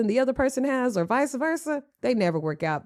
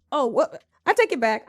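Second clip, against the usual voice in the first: usual speaking voice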